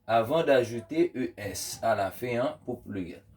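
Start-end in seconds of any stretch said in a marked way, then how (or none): none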